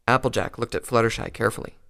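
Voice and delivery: plain reading voice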